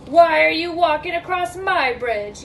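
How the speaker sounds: loud, demanding troll; storybook troll challenge; troll's yell. Acted. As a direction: yell it like a troll guarding his bridge. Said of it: "yelled the hideous".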